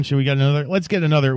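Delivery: ordinary talk, nothing marked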